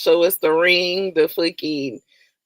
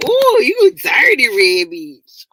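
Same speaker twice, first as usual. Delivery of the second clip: slow, louder teasing jab, ooh stretched out; drawn-out mock insult; 5 semitones higher, 5 dB louder, slower than usual. Streamer repeats his roast of C B, slower and louder. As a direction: savor each word of the roast. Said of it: "so I can say".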